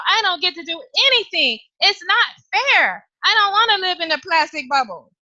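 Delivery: put-on high, complaining child's whine; it's-not-fair pleading; whiny little girl. Girl protests to her parents about the plastic bubble.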